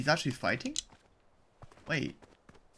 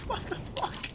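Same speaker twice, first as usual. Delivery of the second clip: whispered laugh, much higher; laughing disbelief; 5 dB quieter than usual. Real, not acted. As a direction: gasp it through laughter.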